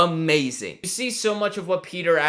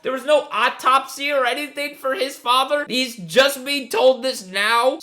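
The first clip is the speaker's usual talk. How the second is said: high, loud, exasperated disbelief; incredulous outburst; 8 semitones higher, 5 dB louder than usual. Real, not acted. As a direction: question it at the top of your range, astounded. Incredulous reaction to butler's confession.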